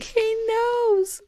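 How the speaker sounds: high-pitched laughing squeal; squeaked through laughter; laughing retelling. Retold or imitated. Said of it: "I started laughing again".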